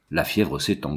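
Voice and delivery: low, grumbling under the breath. A narrator's reading. Méral grumbling to his companions in the car, low and exasperated. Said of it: "grommela Méral".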